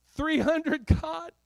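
very high, loud disbelief; incredulous protest. Acted. Soldier questioning God about three hundred, high incredulous cry.

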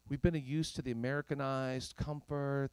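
usual speaking voice

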